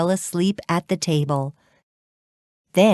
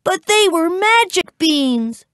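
put-on high-pitched boy's protest; crying out; 12 semitones higher than usual. A narrator's reading. Jack pleads with his angry mother, crying out. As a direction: wail it in storybook distress. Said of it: "Jack cried".

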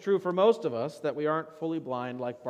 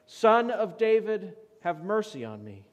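louder cry; 5 semitones higher, 4 dB louder than usual. Acted. Blind beggar calls to Jesus again, louder cry. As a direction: call out over a crowd, then plead. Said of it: "you cry out even louder".

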